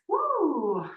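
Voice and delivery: high, drawn-out "woo" of effort; falling whoop of real exertion. Real, not acted. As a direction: let out a whoop at the burn. Feeling the burn mid-exercise.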